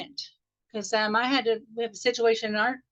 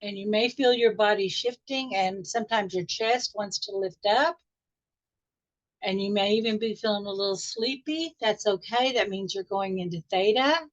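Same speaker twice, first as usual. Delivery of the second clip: guided meditation. Acted. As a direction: ease the group gently back into the room.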